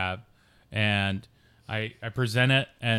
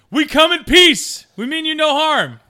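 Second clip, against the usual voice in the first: high, loud, drawn-out proclamation; yelling; 9 dB louder than usual. Acted. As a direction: call it out slowly to a distant beast. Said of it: "So I yell to him".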